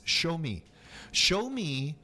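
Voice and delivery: normal talking voice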